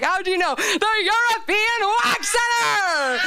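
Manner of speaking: high, slow melodramatic yelling; dramatic shout. Retold or imitated. Comic acting out mom and herself, high shouted melodrama. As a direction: shout it like a soap finale. Mock soap dialogue shouted.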